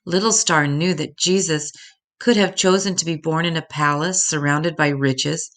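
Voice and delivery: plain reading voice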